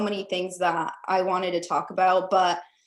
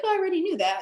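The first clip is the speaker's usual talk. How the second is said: mock realization; 11 semitones higher, much faster than usual. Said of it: "you're like".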